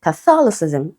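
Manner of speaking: "Catholicism" stressed on THOL; second-syllable stress. Shown as a demonstration. Teacher repeating the exception for students, second-syllable stress. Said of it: "stressed on the second syllable".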